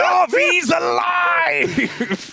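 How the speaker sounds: huge, slow, high hype shout; fighting game announcer. Acted. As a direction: bellow it like an arena announcer.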